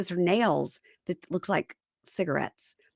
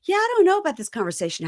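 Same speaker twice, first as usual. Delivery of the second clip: quick, higher-pitched offhand voice; mock casual musing; 8 semitones higher, faster than usual. Playing someone unfazed after a walkout, mock-casual. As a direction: toss it off high and fast. Mocking implausibly casual reply.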